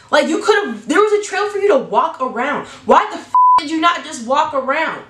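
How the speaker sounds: high-pitched, exasperated comic rant. Real, not acted. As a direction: scold him in disbelief, voice climbing. Comic rant at Oliver crossing lawn.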